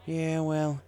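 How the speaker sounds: lightly voiced weary cowgirl; sighing country mare. A narrator's reading. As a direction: sigh it off like it's no use. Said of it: "she sighed".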